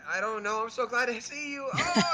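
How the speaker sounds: high, loud gushing; overjoyed outburst. Acted. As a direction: abandon the story and burst out emotionally. Player acts out Ganema's overjoyed reunion.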